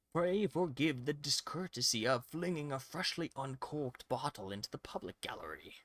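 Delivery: slow, grand mock-aristocrat delivery; haughty prosecutor reading. Prosecutor scolds the defense in a haughty voice. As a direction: lord it over the court, slowly.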